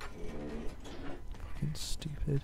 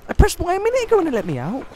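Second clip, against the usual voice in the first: high, loud, rushed complaint; exasperated outburst; 11 semitones higher, 7 dB louder, much faster than usual. Real, not acted. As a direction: protest fast and loud at the game. Gamer shouting at the game.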